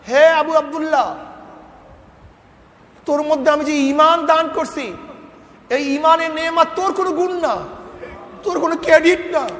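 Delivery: God's stern rebuke, high and forceful; voice from the unseen. Retold or imitated. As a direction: thunder down a reproach from heaven. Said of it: "গায়েব থেকে আক-, আওয়াজ পেলাম".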